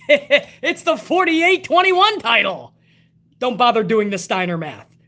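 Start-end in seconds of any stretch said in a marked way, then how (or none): 0.1-2.7 chuckling slowly through the joke; a slow, amused laugh while saying it. Real, not acted. Joking about the new title.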